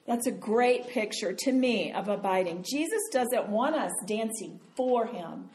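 ordinary talk, nothing marked

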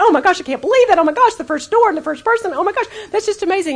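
gushing oh my gosh, imitating thrilled men; excited. Husband amazed in the car.

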